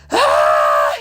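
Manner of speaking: imitating a sudden low yelp of fright; mock startled yelp; startled scream. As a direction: friend sees the snake and yelps in fright. Friend spots snake, screams.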